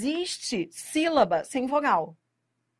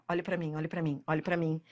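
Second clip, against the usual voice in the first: softer, drawing attention close; intimate aside; 7 semitones lower, 8 dB quieter than usual. Real, not acted. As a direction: lean in and hold their focus. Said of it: "Não fique com dúvida nenhuma". (professor chamando atenção, mais baixo)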